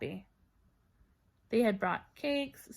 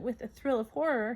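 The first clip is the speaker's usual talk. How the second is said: ordinary delivery, nothing marked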